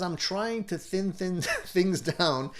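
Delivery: laughing as you read a relatable comment; laughing while reading. Real, not acted. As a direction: stumble on thin with a laugh breaking in. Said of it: "Sorry for laughing".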